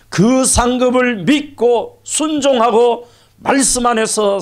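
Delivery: high, ringing pulpit delivery; fervent preaching. Real, not acted. Pastor promising the congregation and their descendants Abraham's blessing, fervent preaching. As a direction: preach in high, emphatic phrases, leaning on key words.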